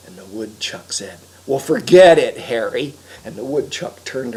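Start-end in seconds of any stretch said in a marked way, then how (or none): 1.4-2.9 dismissive refusal. Acted. Woodchuck refuses to help.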